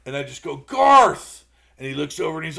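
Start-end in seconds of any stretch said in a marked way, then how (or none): 0.6-1.4 loud call across the crowd. Retold or imitated. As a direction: call out to him, surprised and glad. Calling out to a friend.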